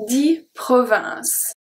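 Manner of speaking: Dix provinces said very slowly, syllable by syllable; slow French demo. Shown as a demonstration. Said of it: "So here we have a few things".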